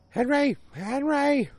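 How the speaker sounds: drawn-out high call for Henry. Retold or imitated. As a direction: call his name up high, slowly. Calling for husband in house.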